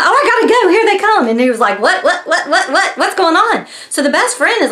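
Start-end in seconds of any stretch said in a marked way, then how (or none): 0.0-1.3 high-pitched hurried sign-off, acted out; mock panic. Caller breaks off in panic. 1.8-3.7 higher-pitched mock bewilderment; freaking out, imitated. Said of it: "freaking out".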